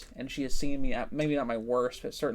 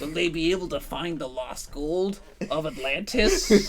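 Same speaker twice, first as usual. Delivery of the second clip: louder, higher, drawn-out mock narration; mock movie-trailer narrator; 4 semitones higher, 5 dB louder, slower than usual. Friends parody an eighties coming of age movie, hammed-up narration. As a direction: play a hammed-up trailer voice-over.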